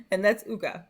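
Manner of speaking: ordinary talk, nothing marked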